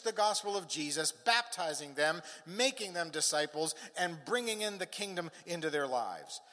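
impassioned preaching with ringing key words. Real, not acted. Preacher urging the congregation to tell the world, preaching with conviction. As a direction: preach it with conviction, leaning on each phrase.

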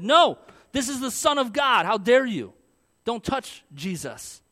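loud, high, indignant defense; defiant shout. Retold or imitated. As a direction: shout them down, outraged. Disciples imagined defending Jesus.

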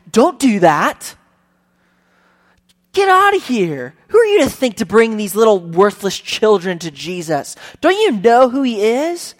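preacher voicing disciples driving parents away, loud scolding; disciples' rebuke. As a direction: shoo them off like an outraged gatekeeper.

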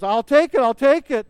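high, loud eager cry; Frodo calling out. Retold or imitated. Hobbit shouting over the arguing council, loud and eager.

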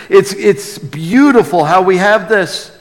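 ordinary delivery

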